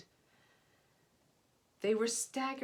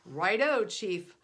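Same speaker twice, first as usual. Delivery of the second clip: barked reply; 7 dB louder, slower than usual. A narrator's reading. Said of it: "barked the men".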